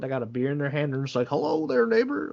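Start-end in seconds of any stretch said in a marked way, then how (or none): 1.3-2.3 high, sing-song neighborly hello; mock friendly greeting. Tipsy resort neighbor waving from a golf cart, high sing-song.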